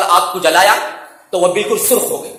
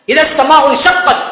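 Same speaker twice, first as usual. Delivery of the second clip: chanted recitation, melodic and raised; Quranic recitation; 5 semitones higher, 3 dB louder than usual. Acted. Preacher reciting the verse to the congregation, chanted. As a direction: intone the verse with tajweed.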